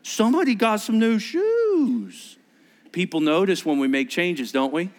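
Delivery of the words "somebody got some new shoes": shrieked high what, then teasing; mock amazement at new shoes; surprised onlookers. People gasping at his new shoes, high mock surprise. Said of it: "people go".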